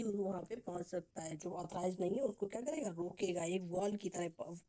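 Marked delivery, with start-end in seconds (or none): none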